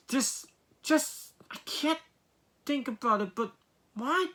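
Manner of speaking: high, halting, incredulous stammer; flustered disbelief. Real, not acted. Reviewer who can't make sense of the rating, flustered. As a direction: stumble over it in high-pitched bewilderment.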